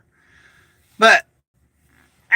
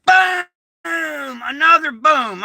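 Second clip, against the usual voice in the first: a much higher yelled boom; shouted explosion boom; 6 semitones higher, much slower than usual. Retold or imitated. Retelling the second blast, yelled booms.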